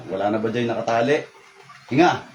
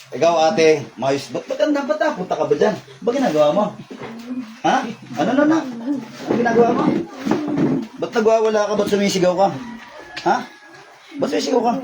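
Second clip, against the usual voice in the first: startled challenging questions; 8 semitones higher than usual. Real, not acted. Healer asking a patient why she is screaming, startled challenge. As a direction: pitch it up sharply, demanding an explanation.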